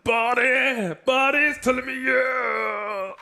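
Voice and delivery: high, slow, stretched-out shout; slow drawn shout. Real, not acted. Caster hyping Body's win to the audience, slow shout. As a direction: draw out Body's name in triumph.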